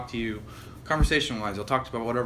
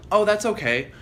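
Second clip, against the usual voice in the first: higher, louder mock-dismissive reply; imitated brush-off; 8 semitones higher, 5 dB louder than usual. Guy mimics his date dismissing his answer, brushing off. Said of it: "kinda brushes my answer off".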